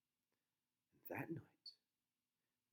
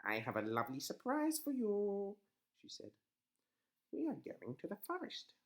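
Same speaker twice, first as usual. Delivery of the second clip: high, sly witch; sweet-talking witch; 11 semitones higher, 3 dB louder than usual. A narrator's reading. Witch voiced.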